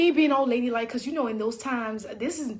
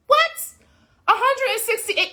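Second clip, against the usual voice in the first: shocked; 7 semitones higher than usual. Retold or imitated. Seeing the Uber fare, high shocked cry. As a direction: gasp at the price, pitched high.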